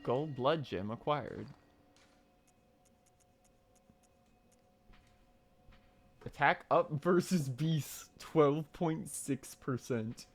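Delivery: slow, measured item readout; slow reading aloud. Real, not acted. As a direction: read the stats out slowly. Reads loot stats.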